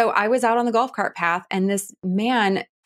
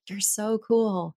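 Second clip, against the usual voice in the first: quiet admiring aside; 5 dB quieter, much slower than usual. Retold or imitated. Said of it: "And I was like".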